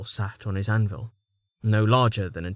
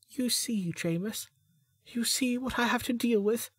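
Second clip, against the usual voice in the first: much higher, softer put-on voice; exaggerated whine; 12 semitones higher, 6 dB quieter than usual. A narrator's reading. Melanie complaining to Jameis, hammed-up high whine.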